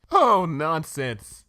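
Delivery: high, slow dismissive lady; Rarity voice. Acted. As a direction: stretch it out airily. Rarity brushes off concern.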